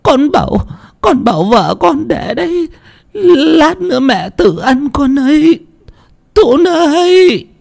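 high, thin character voice; high put-on voice. Son's wife calling high and shrill to the household. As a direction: pitch it way up like another person.

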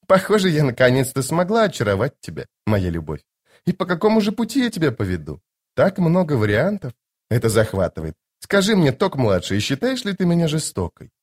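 high-pitched, coy lady; teasing sorceress. Acted. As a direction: toy with him affectionately. Voices Lady Envy teasing Tok.

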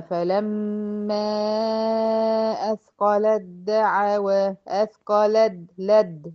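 slow recited أثقلت دعوى, ت vanishing into the doubled د; slow chant, lam going straight to د; slow tajweed demo; recited merge. Shown as a demonstration. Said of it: "بدخل من اللام على الد".

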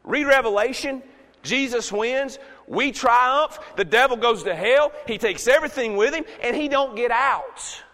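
loud, high proclamation, building to a shout; triumphant preaching. Real, not acted. Preacher tells the church the devil loses, triumphant. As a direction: shout the victory out phrase by phrase.

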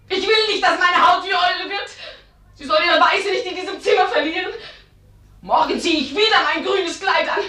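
rising, pleading protest against confinement; defiant outburst. Acted.

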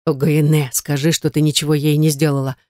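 usual speaking voice

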